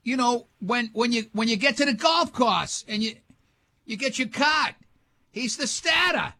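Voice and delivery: high-pitched mock New England local; hammed-up New England dropped r's; New England accent. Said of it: "I never heard an accent so insane".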